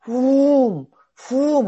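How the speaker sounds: drawn-out "whoom", high and falling; big vocal swoop, loud then easing; swooping whoosh sound. Shown as a demonstration. Teacher showing the brain toggle, swooping sound effect.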